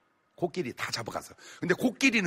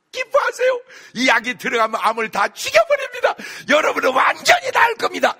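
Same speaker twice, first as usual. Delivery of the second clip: much higher, loud rousing doctor addressing patients; doctor's pep talk; 9 dB louder than usual. Retold or imitated. Doctor rallies his cancer patients, loud and high. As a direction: cheer the patients up like a booming doctor. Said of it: "이랬어요".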